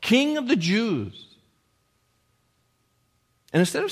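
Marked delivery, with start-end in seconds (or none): 0.0-1.3 high-pitched mock homage; mocking hail. Soldiers kneeling before Jesus, high mocking salute. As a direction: call it out high, drawing out the title. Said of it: "knelt before him and mocked him".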